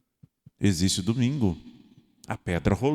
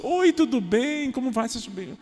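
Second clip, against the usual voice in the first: put-on cheery shop girl; 12 semitones higher than usual. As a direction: greet the customer sweetly. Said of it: "a moça diz assim". (atendente da padaria, voz bem aguda e cantada)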